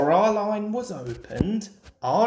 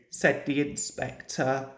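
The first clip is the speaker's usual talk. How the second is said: usual speaking voice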